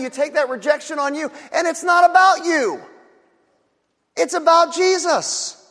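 loud, high impassioned preaching; fiery preaching. Acted. Pastor to congregation, fervent loud preaching.

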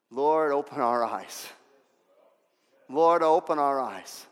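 slow, drawn-out plea to God; slow, heartfelt invocation; earnest prayer. Real, not acted. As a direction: pray it slowly, stretching each word. Preacher prays over congregation.

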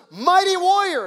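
loud, high heavenly hail; angel's proclamation. Acted. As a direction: call it out like an angel hailing a hero. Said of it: "the angel of the Lord shows up and says".

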